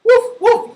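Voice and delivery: high, loud puppy woofs; two short, high-pitched barks; dog bark. Pretend. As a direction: yap like a small dog. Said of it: "you will make the sound".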